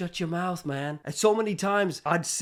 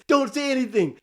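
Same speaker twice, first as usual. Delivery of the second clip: higher and a bit louder, emphatic; urgent inner voice; 7 semitones higher, 4 dB louder than usual. Retold or imitated. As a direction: press the warning urgently. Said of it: "a voice is telling me".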